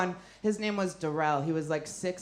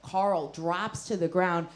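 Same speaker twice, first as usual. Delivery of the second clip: usual speaking voice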